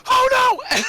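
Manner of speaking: high, loud mock panic shout; panicked oh no. Fernie seeing the reactor again, high panicked shout. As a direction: break off into a panicked yelp. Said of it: "Fernie's like".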